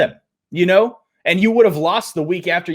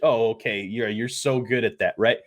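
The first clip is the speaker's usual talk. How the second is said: sarcastic; 8 semitones lower, 6 dB quieter than usual. Acted. Sarcastic reply.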